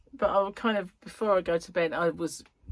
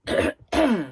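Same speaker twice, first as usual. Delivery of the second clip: cough; 3 semitones higher, 9 dB louder than usual. Real, not acted. Coughing mid-vlog.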